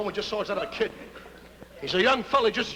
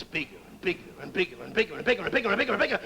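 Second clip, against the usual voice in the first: gather speed and climb with each repeat; accelerating build; faster than usual. Retold or imitated. Comic describing an approaching plane, speeding up and rising. Imitating a plane coming closer.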